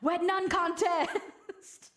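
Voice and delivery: ordinary talk, nothing marked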